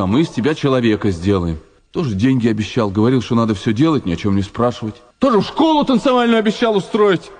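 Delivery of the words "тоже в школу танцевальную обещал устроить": high-pitched agitation. Acted. Agitated recalling promises.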